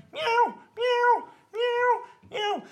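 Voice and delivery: very high, loud urgent meows; a cat trying to raise the alarm; cat meowing. Retold or imitated. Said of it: "Lassie runs up to them and starts going".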